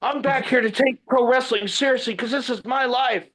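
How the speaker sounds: high-pitched, indignant retold yell without extra volume. Trainee telling off the visiting trainer, retold mild yell. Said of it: "I started yelling at him".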